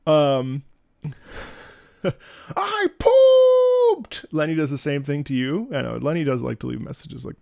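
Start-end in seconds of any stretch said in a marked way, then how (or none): 2.5-4.2 very high, loud squealed announcement; dog's voice. Retold or imitated. Owner voicing his dog proud of pooping. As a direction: squeal it with toddler pride. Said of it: "we have a voice for it".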